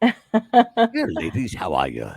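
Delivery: hammed-up British showman addressing the ladies. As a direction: slide down deep on the last words. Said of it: "Sound more like [name] than [name]".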